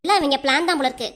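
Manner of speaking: ordinary talk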